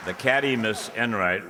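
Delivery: ordinary delivery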